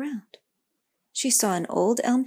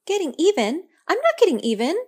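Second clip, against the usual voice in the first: higher, louder indignant burst; in a great rush; 10 semitones higher, 9 dB louder than usual. Acted. Girl protesting to the tree, higher and louder. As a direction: blurt it out, stung by the accusation. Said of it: "the words coming from the girl in a great rush".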